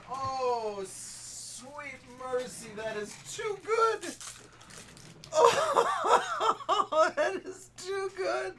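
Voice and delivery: a high voice that breaks up into laughing sobs; overwhelmed by it, cracking up; laughing tears. Real, not acted. Streamer overcome by the joke card, laughing and crying.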